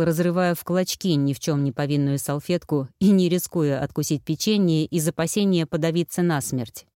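ordinary delivery

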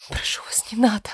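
whispered, frightened plea; trembling whisper. A narrator's reading. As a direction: beg under your breath, barely voiced. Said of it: "прошептала я, дрожа всем телом".

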